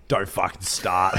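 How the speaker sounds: slow, gruff mock snap; imitating a grumpy friend. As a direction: play the sulking mate warning him off. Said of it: "He's like".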